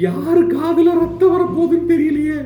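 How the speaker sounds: high, drawn-out comic wail of dread; mock despair. Commentator dreading the sister's coming lament, mock wailing. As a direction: cry to the heavens, hammed-up and high.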